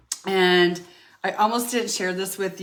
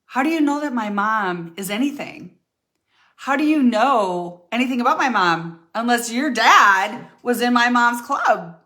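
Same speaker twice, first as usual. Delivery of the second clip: defiant retort; 4 semitones higher, 6 dB louder than usual. Retold or imitated. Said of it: "I want you to say to them".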